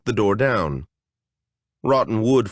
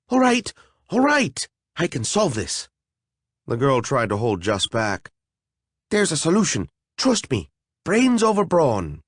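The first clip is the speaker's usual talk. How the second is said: higher, hurried fairy reassuring; eager fairy; 5 semitones higher than usual. Acted. A fairy urging trust in her plan, higher and hurried. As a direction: talk fast to convince the others.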